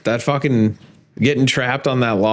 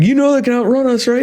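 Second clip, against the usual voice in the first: higher, fast nervous question; mock panic; 13 semitones higher, faster than usual. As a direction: rattle it off scared, pitched up and quick. Panicked question while fleeing.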